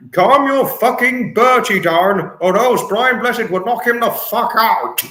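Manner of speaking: mock outburst. Streamer voicing a mock rant about the swearing bird. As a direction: fire off an exaggerated threat. Voiced quote about Bertie.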